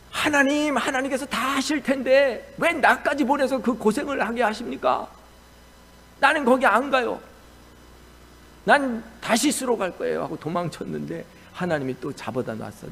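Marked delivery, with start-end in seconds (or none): 0.1-10.2 high, loud pleading complaint; Jonah's protest. Retold or imitated. Jonah protesting to God, high and loud pleading. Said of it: "요나가".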